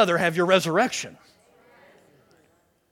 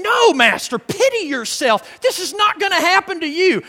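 raised, emphatic mock protest; retold rebuke; 8 semitones higher, 4 dB louder than usual. Preacher voices Peter taking Jesus aside, imitated rebuke. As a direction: protest firmly, imitating an alarmed follower. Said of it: "began to rebuke him".

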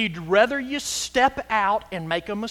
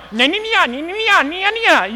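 high, loud "nya, nya" babble; mock foreign chatter; 9 semitones higher, 7 dB louder than usual. Pastor imitating Vietnamese nail salon ladies talking, high mock babble. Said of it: "They're going".

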